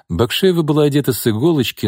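plain reading voice

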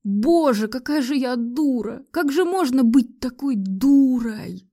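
high, drawn-out despair; anguished self-reproach. A narrator's reading. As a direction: berate yourself, stretching the last words. Heroine berates herself in anguish.

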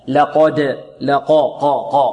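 لقد with a thick, full-mouthed ق; heavy qaf. Shown as a demonstration. Teacher drills the syllable, heavy qaf. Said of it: "القاف مفخمة".

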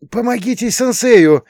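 urgent high request; pleading call for help. A narrator's reading. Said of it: "попросил я".

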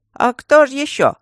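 high, loud scoffing snort; snorting stone guardian. Acted. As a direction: scoff it like a grumpy statue. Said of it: "фыркнул второй".

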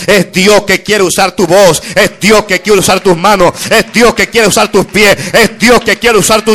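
creciendo en fuerza, cada vez más alto y más fuerte; preaching crescendo. Acted. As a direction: empuja la congregación hacia el clímax. Preaching builds to climax. (predicación que sube con fuerza)

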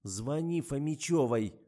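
stern order. A narrator's reading. Investigator orders the driver to phone, stern. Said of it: "сурово приказала я".

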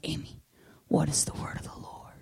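hushed, reverent whisper, acted; soft imitation of awed students; whispered bedside question.

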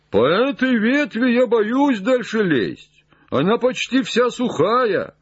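high, far-carrying worried call. Acted. Jupiter warns that the branch is dry, high distant call. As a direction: call your fear down from the branch. Frightened climber calls down from afar.

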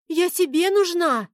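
put-on girl squeaking. A narrator's reading. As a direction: pipe up like a feisty young girl. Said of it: "вякнула девчонка".

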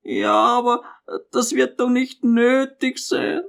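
high, feeble objection; hesitant, high-voiced old mother; weak resistance. A narrator's reading. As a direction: protest weakly in a high voice. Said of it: "ihr schwacher Widerstand war noch nicht völlig gebrochen".

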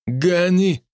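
grin and demand payment brazenly; russian street thug. Acted. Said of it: "нагло залыбился парень".

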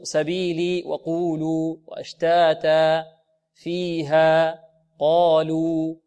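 slow, evenly held long vowels in سبيلي وقولوا وأشتاتا; natural madd. Shown as a demonstration. Teacher listing practice words, measured natural madd. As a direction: give فيها قالوا two equally stretched vowels each. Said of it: "وبعد أن تضبط".

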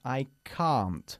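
"can't" with the long open A of "car"; British can't. Shown as a demonstration. Teacher shows students the British "can't" with a car vowel. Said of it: "El mismo sonido que nuestra palabra casi para coche".

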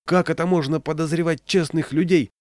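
a high indignant exclamation; a touch of fiery indignation; heated outcry. A narrator's reading. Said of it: "запальчиво выкрикнул он".

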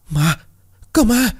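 very high cry of alarm; terrified scream. A narrator's reading. Group sees the ghost behind them, high-pitched panicked scream. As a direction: shriek a warning in panic. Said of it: "quay sang rồi hét toáng".

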